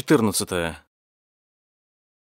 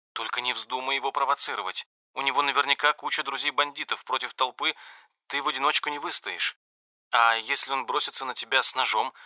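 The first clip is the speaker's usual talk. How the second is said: anxious, hushed pleading; softly voiced anxious girlfriend; 4 semitones higher, 6 dB quieter, faster than usual. A narrator's reading. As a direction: quietly beg him to be careful. Li Jing pleading on phone. (тихая встревоженная мольба)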